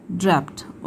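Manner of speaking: ड्रैप्ड said low and soft, ending "pt"; final D as T. Shown as a demonstration. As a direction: softly close ड्रैप्ड on a t. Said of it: "डी का sound ट आएगा". (ड्रैप्ड को धीमे से ड्रेप्ट बोलकर)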